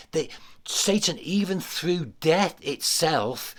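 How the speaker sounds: usual speaking voice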